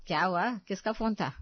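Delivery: ordinary talk, nothing marked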